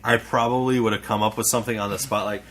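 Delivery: usual speaking voice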